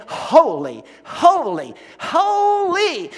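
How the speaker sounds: loud ringing cries of holy; excited proclaiming. Retold or imitated. Wings and wheels crying out around the chariot, loud and excited. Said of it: "they keep saying".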